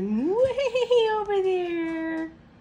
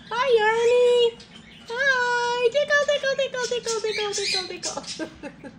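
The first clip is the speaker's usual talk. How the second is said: talking to a dog; 6 semitones higher, 5 dB louder than usual. Real, not acted. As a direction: greet the dog up high. Greeting dog, loud high.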